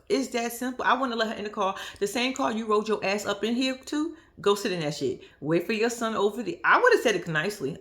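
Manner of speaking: normal talking voice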